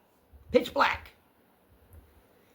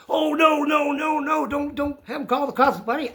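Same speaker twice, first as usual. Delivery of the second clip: high, repetitive imitated protest; retold panicked plea; 9 semitones higher than usual. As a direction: imitate a man begging, pitched up. Acts out old man's panicked plea.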